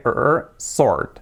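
drawn-out English "R", then "sword" as "sord"; stressed R sound. Shown as a demonstration. Teacher stressing the R for learners, held English R. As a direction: slowly hold the "R", w silent in "sword". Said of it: "muy importante".

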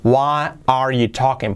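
ordinary delivery

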